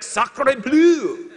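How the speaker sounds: playful mock French, a laugh in the voice; French exclamation. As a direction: throw out Sacre bleu with a grin, hamming the French. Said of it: "I don't know what that means, but I like to say it".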